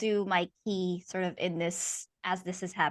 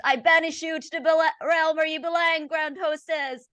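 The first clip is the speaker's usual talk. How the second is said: higher, louder, fervent banishing; rising exorcism cry; 8 semitones higher, 5 dB louder than usual. Acted. Priest repeating the banishment, higher and fervent. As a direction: push harder, climaxing the rite. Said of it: "and then says".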